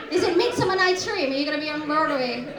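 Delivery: ordinary talk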